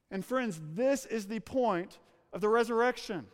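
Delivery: usual speaking voice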